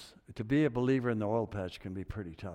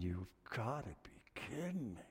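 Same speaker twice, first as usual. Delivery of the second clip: acted stunned aside; 13 dB quieter than usual. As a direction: say it under your breath, stunned. Acted stunned aside after Gary's reply.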